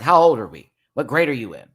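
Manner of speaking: loud, exasperated scolding; incredulous scold. Retold or imitated. Calling out petty contestants, loud exasperated scolding. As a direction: talk down to them like children.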